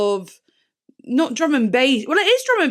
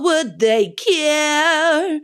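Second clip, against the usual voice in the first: operatic rock voice; 2 semitones higher, 3 dB louder, much slower than usual. Retold or imitated. Imitating the singer's slow operatic line for viewers. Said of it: "rock and roll voice".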